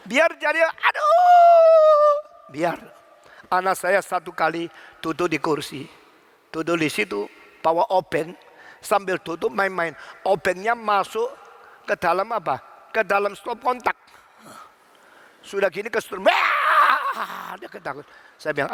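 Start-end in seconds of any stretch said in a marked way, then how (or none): 0.1-2.1 long, high, loud "aduh" wail; mock howl of pain; hammed-up yelp of pain. Acts child yelling in pain. 16.2-17.5 high, loud mock bawl; hammed-up startled cry; imitated child's wail. Imitates shocked child wailing.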